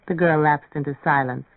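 usual speaking voice

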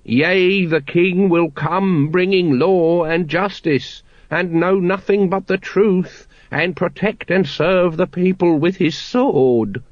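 dramatic recall. A narrator's reading. Brother Plasterer reciting granddad's prophecy, solemnly. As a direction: intone it like an old prophecy. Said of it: "with the effort of dramatic recall".

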